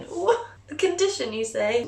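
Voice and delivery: high, mock-astonished viewer voice; mock shocked listener. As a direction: play a gasping imaginary viewer. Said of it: "mock listener interjection".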